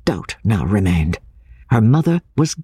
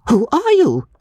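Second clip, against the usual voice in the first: high, challenging woman's voice; resolute cry; 13 semitones higher than usual. A narrator's reading. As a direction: confront a stranger with a high-pitched demand. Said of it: "she cried, facing me resolutely".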